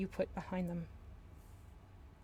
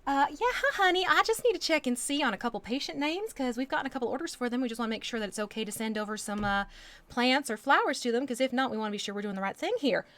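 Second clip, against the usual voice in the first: put-on syrupy Southern accent, higher and louder at first; honeyed Southern accent to charm the nurse; sweet Southern twang; 4 semitones higher, 5 dB louder than usual. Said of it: "throw in that little bit of a twang and start calling people honey".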